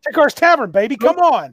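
high, hyped-up plug; playful boasting. Pretend. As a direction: egg them on in mock disbelief. Joins in, playing the boastful introducer.

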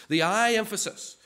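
loud, high-pitched weight on "I"; stress on I. Shown as a demonstration. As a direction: hit "I" hard and hold it. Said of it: "Do you hear the emphasis?".

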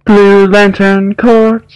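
high, slow, drawn-out proclamation; high sustained mock-heroic announcing; mock fanfare announcement. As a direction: sing it out like a grand title reveal. Mock announcer answer to prompt.

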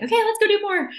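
high, loud burst of eagerness; eager go-getter. Retold or imitated. Eager urge to keep working.

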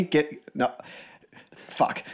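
ordinary talk, nothing marked

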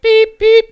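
high, loud beep beep; imitated beeping. Imitating the temperature gun, high loud beeps. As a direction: mimic the thermometer gun. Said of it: "it's just like".

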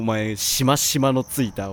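usual speaking voice

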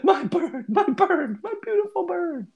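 high-pitched mock wail for a bird; over-the-top lament in a squeaky high voice; hysterical wail. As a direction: wail it high, hammed-up distress. Player acting hysterical character's wail.

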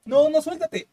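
urging shout. Retold or imitated. Crew urging her.

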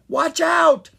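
sharp, high, loud warning; yelling. A narrator's reading. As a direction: yell out in alarm. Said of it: "Sprite yelled".

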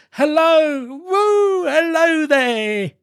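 very high, drawn-out hollers; joyful whooping. A narrator's reading. Scrooge transported with joy, whooping hello. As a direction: whoop and halloo, stretching the last call.

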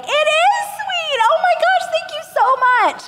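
very high, gushing thanks; overjoyed naive gratitude. Retold or imitated. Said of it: "And I was like".

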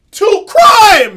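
high, loud shout; outraged shout. Real, not acted. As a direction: yell it in disbelief. Outraged punchline.

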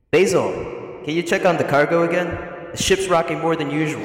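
like a distant memory, echoing; echo effect. Acted. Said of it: "an echo effect for this 'cause it's like a memory".

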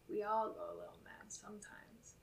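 whispered, menacing killer line; creepy whisper. Retold or imitated. Podcaster whispering Billy's creepy line to her cohost. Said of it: "And then he says".

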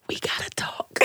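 hushed, secretive whisper. Acted. Girlfriend hugged after proposing, whispered in her ear. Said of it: "You gotta whisper that in her ear".

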